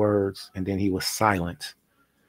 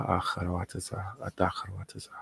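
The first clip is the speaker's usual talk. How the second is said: whisper; 9 dB quieter, faster than usual. Real, not acted. Trailing prayer words.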